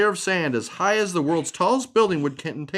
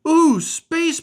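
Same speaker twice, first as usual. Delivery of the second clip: excited ooh; 13 semitones higher, 6 dB louder than usual. Acted. Pebble excited by space.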